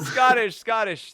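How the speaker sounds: loud laughing call of Scottish; laughing announcement. Real, not acted. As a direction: shout out the word through a laugh. Announcing the next word.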